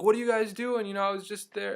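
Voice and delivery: high, loud imitation of a tipsy man; mock-yelling drunk bystander; drunk stranger. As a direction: call it out as a boisterous drunk. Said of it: "he obviously had been drinking a lot".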